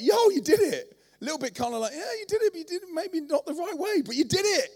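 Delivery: high, loud delighted praise; God cheering the runaway who made it; proud cheer. Retold or imitated. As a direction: cheer him on like a proud father. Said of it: "He's like".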